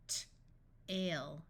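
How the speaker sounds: crisp T, pause, then "ale"; sounded out. Shown as a demonstration. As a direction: break "T-, ale" into separate sounds. Phonics teacher sounds out, then blends.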